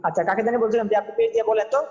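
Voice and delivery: ordinary talk, nothing marked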